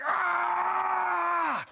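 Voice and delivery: long, very high wail of frustration; frustrated scream. Retold or imitated. Retelling his meltdown before the audience, frustrated scream. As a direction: let out a long acted-out scream. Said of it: "my mind snapped".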